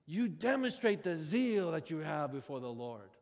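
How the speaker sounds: ordinary talk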